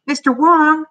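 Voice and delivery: high, loud hail; calling out. A narrator's reading. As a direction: call after someone to get their attention. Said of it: "he called".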